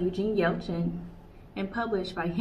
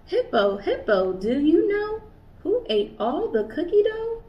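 higher, louder questioning call; curious kangaroo asking; 8 semitones higher, 5 dB louder than usual. Acted. Kanga asking each animal.

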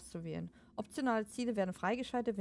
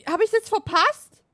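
usual speaking voice

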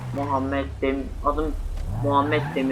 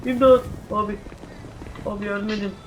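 crying for help; 7 semitones higher than usual. Acted. A bitten boy shouts for anyone to help him. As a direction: call out for help in mock panic. Calling for help after bite.

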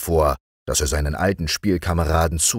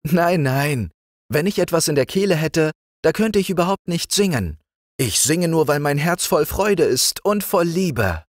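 much higher, sweet princess nightingale; sweet little voice; 12 semitones higher, 3 dB louder than usual. A narrator's reading. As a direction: call down sweetly from the branches. Nightingale's reply.

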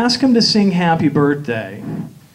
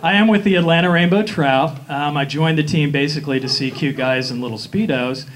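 ordinary delivery

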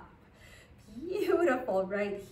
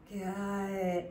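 usual speaking voice

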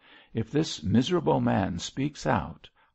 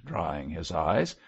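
plain reading voice

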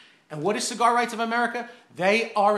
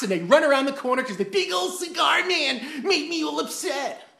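ordinary delivery

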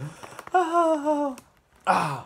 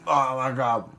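normal talking voice

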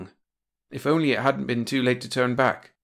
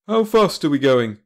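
louder, higher brisk question; captain Brad; 5 semitones higher, 7 dB louder than usual. A narrator's reading. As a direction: demand it of the crew. Captain questions his crew.

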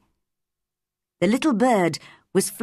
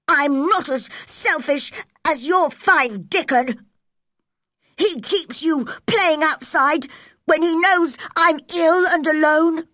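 loud, raised, sneering complaint; jealous spoilt boy; 9 semitones higher, 3 dB louder than usual. A narrator's reading. Jealous Colin sneers at Mary about Dickon, loud. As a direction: sneer about his rival.

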